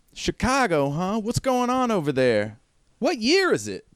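high-pitched inquisitive character; Eddie, curious. Acted. Eddie asking about Chicago, high and curious. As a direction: question the newcomer in a high voice.

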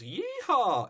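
high whooping yeehaw, lightly hammed; mock yell. Host retelling Applejack jumping down, mock whooping yeehaw.

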